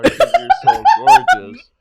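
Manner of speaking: high, slow, laughing tease; laughing while flirting. Retold or imitated. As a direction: crack up mid-line. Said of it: "then he'd be like".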